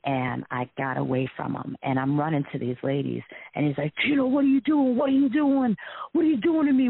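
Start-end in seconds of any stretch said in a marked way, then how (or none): 4.0-6.9 high, loud mock protest; imitated frantic yelling. Ex to woman fleeing toward the ladies, acted high yelling. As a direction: yell it in a much higher voice, imitating him. Acts out ex shouting at her.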